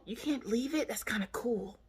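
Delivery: put-on small hushed kid voice; imitated little girl. Girl wanting blood left there, softly imitated.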